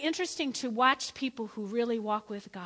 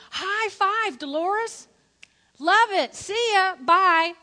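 high, loud, breezy high-five greeting; playful God voice; 7 semitones higher, 8 dB louder, much slower than usual. Retold or imitated. God high-fiving the congregation, bright and breezy. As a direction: toss off a cheery high five and leave. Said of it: "and he goes".

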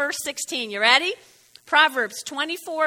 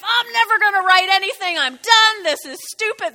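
retold yell; 5 semitones higher, 5 dB louder than usual. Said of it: "he yells at his wife".

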